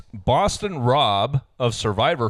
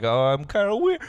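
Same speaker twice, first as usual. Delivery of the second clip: quirky lady voice; 11 semitones higher, faster than usual. Retold or imitated. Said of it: "Her, her voice was a combination of?".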